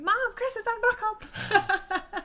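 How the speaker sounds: high, stretched-out tattletale yell; mock childhood scream, loud and drawn out; tattling kid scream. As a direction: holler for Mom like a tattling kid. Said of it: "unbuckle and then scream".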